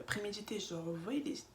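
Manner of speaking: ordinary talk, nothing marked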